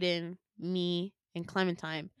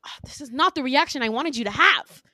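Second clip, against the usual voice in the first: louder, higher, indignant; exasperated protest; 6 semitones higher, 9 dB louder than usual. Retold or imitated. Villain upset Winter rejects his gift, exasperated. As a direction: insist on it, wounded and frustrated.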